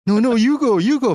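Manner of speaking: high, loud polite deferral; hammed-up after you, no you; mock polite fighter. Mocking fighters deferring to each other.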